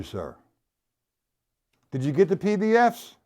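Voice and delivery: usual speaking voice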